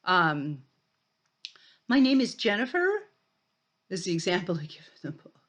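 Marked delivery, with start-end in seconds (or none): 1.9-2.9 "Jennifer" rising high like a question; uptalk. Shown as a demonstration. Lecturer showing students uptalk, with a rising end. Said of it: "women do something called uptalk".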